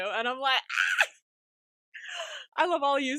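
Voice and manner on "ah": very high fangirl squeal; a short high squeal of joy; delighted squeal. Real, not acted. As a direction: squeal like your favourite team scored.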